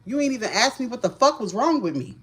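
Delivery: normal talking voice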